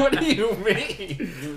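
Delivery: put-on high, drawn-out Mexican-accented disbelief, laughing; hammed-up Mexican accent. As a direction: imitate a Mexican gamer through laughter. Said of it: "there was a Mexican guy on our team".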